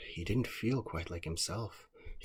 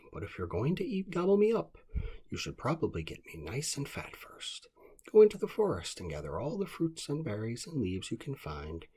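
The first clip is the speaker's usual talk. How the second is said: high, louder sly groundhog; scheming groundhog; 6 semitones higher, 6 dB louder than usual. Acted. Groundhog tricks the wolf into fetching food, high sly voice. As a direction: sell the plan, high and bright.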